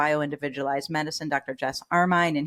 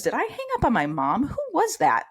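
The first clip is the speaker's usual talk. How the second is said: high, bewildered self-questioning; rising in alarm at her own memory gaps; 10 semitones higher than usual. Retold or imitated. Forgetful mother wondering who she hung up on, bewildered. Retelling memory loss panic.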